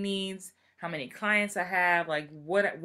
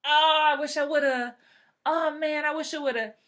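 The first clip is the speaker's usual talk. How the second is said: higher, louder regretful groan; regretful whine; 6 semitones higher, 4 dB louder than usual. Retold or imitated. Actor after an audition, moaning regret. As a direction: moan about what you should have done. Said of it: "you're like".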